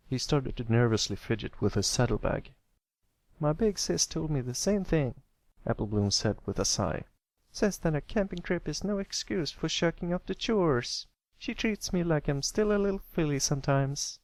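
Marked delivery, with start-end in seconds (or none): 3.4-5.2 higher filly voice with a sigh behind it; a little farm filly grumbling about her sister; young filly. A narrator's reading. Narrator voices filly Applebloom. 7.5-14.2 higher filly voice with a sigh behind it; a little farm filly grumbling about her sister; young filly. A narrator's reading. Narrator voices filly Applebloom.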